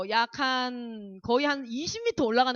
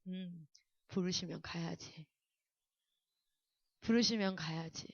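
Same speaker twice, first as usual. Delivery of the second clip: drained surrender; 7 semitones lower, 12 dB quieter than usual. Retold or imitated. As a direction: let the body go limp as you yield. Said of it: "온몸에 힘이 쫙 풀리면서".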